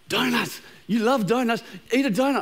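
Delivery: the voice in his head coaxing him toward donuts, mock tempting; tempting inner voice. Pastor voicing his craving inner voice, playful temptation. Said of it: "these voices will start in my brain".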